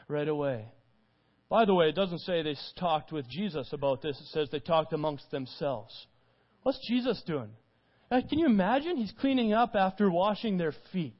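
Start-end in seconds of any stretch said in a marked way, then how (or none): none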